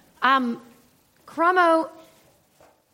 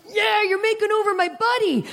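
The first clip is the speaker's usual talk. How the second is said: cheerful cop; 7 semitones higher than usual. Retold or imitated. The cop reveals the prank.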